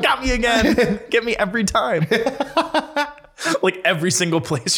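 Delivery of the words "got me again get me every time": mock defeated laugh. Laughing at being fooled.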